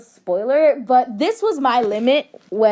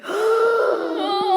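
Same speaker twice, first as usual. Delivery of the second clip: shocked gasp; 10 semitones higher than usual. Pretend. Acting Spider-Man death scene.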